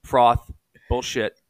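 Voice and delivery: slow, drawn-out dismissal; slow blunt callout. Retold or imitated. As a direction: spell it out slowly and bluntly. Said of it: "He goes".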